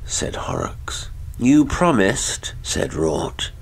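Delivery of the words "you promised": slightly hammed-up high, slow reminder; uneasy lover. A narrator's reading. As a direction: pitch it high, drawing it out. Nervous lover voiced against stern husband.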